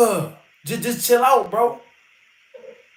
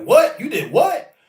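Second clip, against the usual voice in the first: incredulous fighters; 5 semitones higher, slower than usual. Retold or imitated. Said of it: "they be like".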